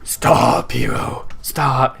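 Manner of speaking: desperate creature voice, loud and strained; pleading monster. Acted. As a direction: beg like a beaten monster. Monster head begs the hero.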